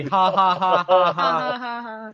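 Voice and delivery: mock Tommy laugh.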